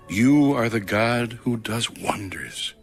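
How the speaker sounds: ordinary talk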